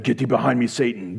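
low, dramatic banishing voice; playfully spooky, deep command; ominous rebuke. Acted. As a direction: intone it darkly, dropping away on the last word. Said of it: "or something like that".

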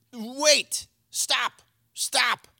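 loud urgent command to stop; urgent halt. Acted.

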